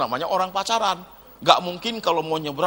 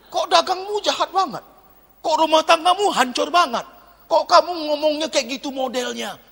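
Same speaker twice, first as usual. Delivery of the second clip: accusing complaint; 8 semitones higher than usual. Retold or imitated. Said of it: "orang tanya kita".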